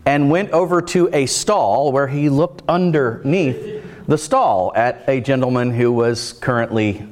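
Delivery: normal talking voice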